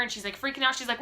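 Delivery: ordinary talk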